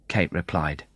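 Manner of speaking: usual speaking voice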